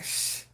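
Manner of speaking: soft hiss for silence; whispered shush. Pretend. Everyone hushing each other under the boss, whispered shh. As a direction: hush everyone quietly.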